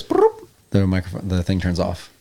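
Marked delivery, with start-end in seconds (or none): 0.0-0.5 very high trilled bloop; radio switch-off noise. Acted. Game master shows the radio turning off, high trilled noise. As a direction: mimic a radio cutting out, high-pitched. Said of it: "As it goes".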